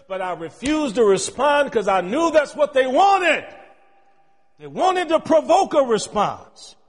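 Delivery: loud, driving preacher's cadence; preaching at full force. Acted. Pastor preaching sin to the congregation, full-force preaching. Preaching about not responding.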